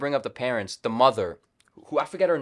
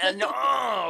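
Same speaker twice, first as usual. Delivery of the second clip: nasal voice; 10 semitones higher, 5 dB louder than usual. Shown as a demonstration. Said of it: "a nasally voice".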